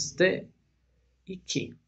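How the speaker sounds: "ste" then "ki", split apart; wrong split. Shown as a demonstration. Teacher showing a wrong syllable split to students. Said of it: "diríamos".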